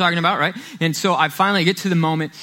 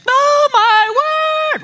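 squealed far higher and louder, slow and drawn out; Lauren's big reaction; 6 dB louder, much slower than usual. Retold or imitated. Wife reacting to a good gift, squealing with delight. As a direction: gush like you just opened the best gift ever. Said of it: "you give it to Lauren".

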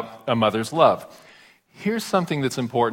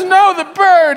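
put-on wailing little girl; 9 dB louder, slower than usual. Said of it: "And she goes".

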